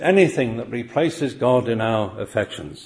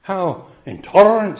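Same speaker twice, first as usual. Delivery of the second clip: a touch of mock outrage, pitched higher; mock indignation; 7 semitones higher, 5 dB louder than usual. Preacher imitating people rejecting Jesus' words, mock indignant. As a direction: sniff it like an offended critic. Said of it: "say people".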